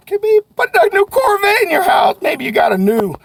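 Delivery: high, loud hype; excited shout. Real, not acted. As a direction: fire up the sales team. Hyping the reward of add-ons.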